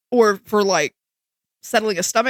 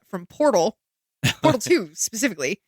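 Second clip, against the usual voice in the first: usual speaking voice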